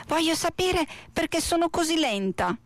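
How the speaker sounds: high small snail voice; high-pitched storybook snail; questioning little snail. A narrator's reading. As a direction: ask it up in a small, high register.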